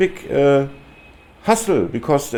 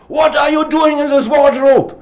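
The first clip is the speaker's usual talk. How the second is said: furious shout, much higher and louder; angry shout; 10 dB louder than usual. Retold or imitated. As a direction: yell it at the man hiding in the wardrobe. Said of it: "he shouts at him".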